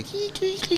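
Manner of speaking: high squeaky cri cri noises; modem screech imitation. Telling the audience about old internet, mock modem squeal.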